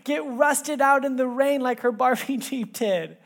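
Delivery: high, loud and slow, eager; God's voice. Retold or imitated. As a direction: voice God bursting to show his gift. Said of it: "God ... just saying".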